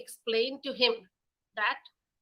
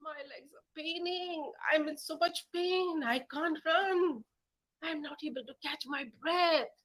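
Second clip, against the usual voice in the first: tired kitten; 6 semitones higher than usual. Acted.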